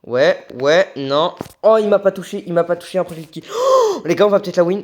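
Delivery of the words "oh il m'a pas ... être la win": loud, rising hype; building excitement toward a win; excited gamer. Real, not acted. (il sent la victoire, de plus en plus excité)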